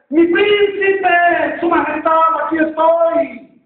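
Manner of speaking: high, drawn-out cry for rescue; princess's long, high-pitched yell to be found; desperate shout. Acted. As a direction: cry out with all you can muster. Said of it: "como pudo, gritó".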